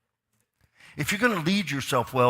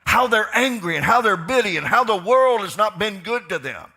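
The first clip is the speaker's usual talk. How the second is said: much higher and louder, half-sung preaching; preaching at full force; 8 semitones higher, 9 dB louder than usual. Acted. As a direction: build it into a singing preacher's cry.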